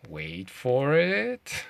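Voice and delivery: very slow, drawn out, rising at the end; teasing suspense. Real, not acted. Teasing viewers before revealing the Polish name, drawn-out suspense. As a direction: stretch it out to hold back the reveal.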